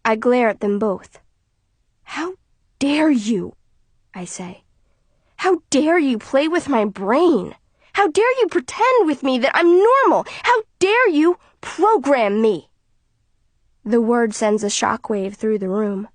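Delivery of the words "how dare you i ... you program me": furious accusation. Acted. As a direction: hurl each how dare you. Daughter confronts parents furiously.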